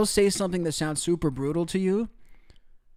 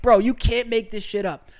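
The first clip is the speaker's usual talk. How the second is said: ordinary talk, nothing marked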